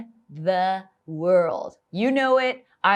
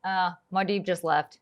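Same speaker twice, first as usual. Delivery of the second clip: ordinary talk, nothing marked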